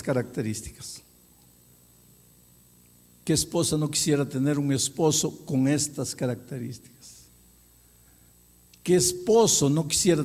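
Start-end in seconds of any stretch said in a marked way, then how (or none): none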